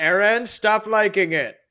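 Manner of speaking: high, loud scolding parody voice; mock scolding. Erwin scolding Eren in the parody, hammed-up high voice. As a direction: scold him like a cartoon commander. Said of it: "And Erwin's like".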